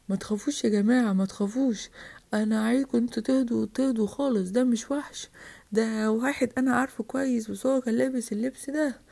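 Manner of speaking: low, soft reassuring voice; calming reassurance. Acted. Adult tells frightened crowd it's no monster, calming.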